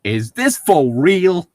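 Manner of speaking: a hint of suspicion, drawn out; suspicious. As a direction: ask it like you smell a trick. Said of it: "Artie asked suspiciously".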